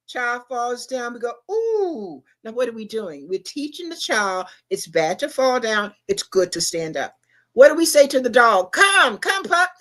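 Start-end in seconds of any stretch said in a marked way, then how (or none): 1.5-2.2 high sympathetic wince sound; a pitying ooh as if someone took a tumble. Retold or imitated. Parent reacting as the toddler falls, high pitying ooh. 8.7-9.7 high, louder coaxing call; calling a puppy. Retold or imitated. Owner calling the dog to come, high coaxing voice.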